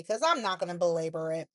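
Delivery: ordinary talk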